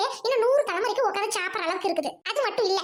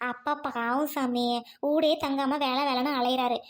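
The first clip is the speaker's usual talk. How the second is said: gentle pleading; 7 semitones lower, slower than usual. Acted. Mother gently coaxing her daughter to study. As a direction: coax her kindly, taking your time.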